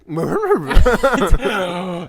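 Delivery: high, loud cartoon dog giggle; Scooby-Doo laugh. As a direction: laugh like the cartoon Great Dane. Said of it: "That was a good Scooby impression".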